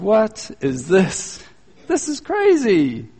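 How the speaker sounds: drawn-out, high-pitched mock disbelief; incredulous. Couple watching everyone laugh in church, slow incredulous reaction. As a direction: stretch each phrase in exaggerated bafflement.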